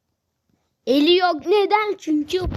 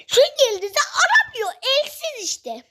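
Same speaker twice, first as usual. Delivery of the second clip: laughing put-on voice; 8 semitones higher than usual.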